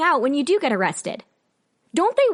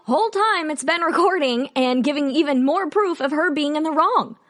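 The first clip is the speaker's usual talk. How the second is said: ordinary talk, nothing marked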